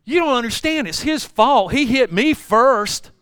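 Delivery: put-on indignant boy. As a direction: insist like a kid sure he's right. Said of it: "He goes".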